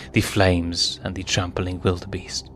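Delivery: ordinary delivery, nothing marked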